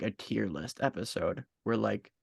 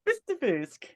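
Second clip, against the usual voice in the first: put-on Russian accent; 12 semitones higher than usual. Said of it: "went into Russian again".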